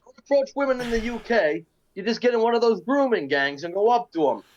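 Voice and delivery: put-on British accent. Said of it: "the British accent".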